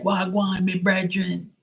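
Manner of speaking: high-pitched, playful Jamaican impersonation; Jamaican accent. Retold or imitated. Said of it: "The Jamaicans ... They're like".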